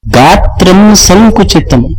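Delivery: slow, drawn-out verse line; verse quote. Acted. Preacher repeats the verse line to explain it, slowly. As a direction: savor the Sanskrit phrase slowly.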